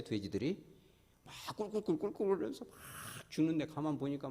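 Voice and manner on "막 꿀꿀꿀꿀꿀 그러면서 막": high, soft mock oinking; pig oinks. Preacher mimicking drowning pigs, light mock oinks. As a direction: squeal like the dying pigs.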